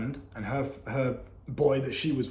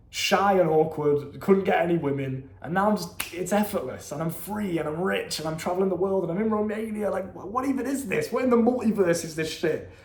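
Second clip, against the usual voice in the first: proud elation; 4 semitones higher than usual. Retold or imitated. Man drunk on a night out marvels at his life, proud and elated. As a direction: relive the moment of having made it, elated. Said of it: "I had this moment of just, like, pride".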